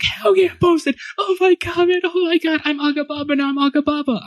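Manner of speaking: the dad bursting with joy at his son's post; thrilled dad. Retold or imitated. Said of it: "My dad's like".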